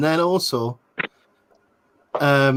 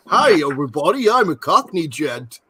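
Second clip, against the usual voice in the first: lightly voiced Hollywood-school Cockney; fake Cockney. Mocking Hollywood Cockney school to friends, light fake Cockney. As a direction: parody of an American actor's Cockney. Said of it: "they all sounded like this".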